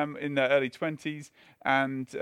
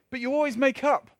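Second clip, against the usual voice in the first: much higher Northern Irish lilt, playful; mock Northern Irish accent; 9 semitones higher, 3 dB louder than usual. Pastor quotes the wife's reassurance in a Northern Irish accent. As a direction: ham up Nellie's Northern Irish voice. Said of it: "That needs to be in Irish, doesn't it?".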